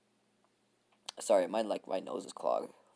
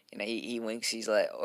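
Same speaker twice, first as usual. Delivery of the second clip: ordinary talk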